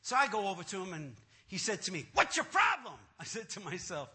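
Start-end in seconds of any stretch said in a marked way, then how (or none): 2.1-3.0 high, loud mimicked confrontation; loud challenge. Pastor confronting the reluctant man, loudly demanding. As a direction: confront him as the pushy pastor.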